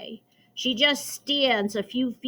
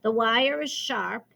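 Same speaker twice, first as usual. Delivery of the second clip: usual speaking voice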